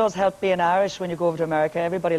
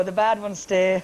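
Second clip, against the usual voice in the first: normal talking voice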